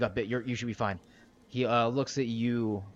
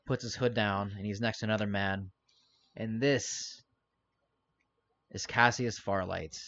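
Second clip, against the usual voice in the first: ordinary delivery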